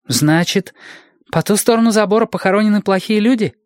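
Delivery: put-on high child's voice, quickening; high, eager boyish questioning; curious little boy. A narrator's reading. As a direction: blurt the question as a small boy. Boy draws a conclusion, asking.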